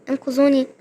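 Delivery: ordinary talk, nothing marked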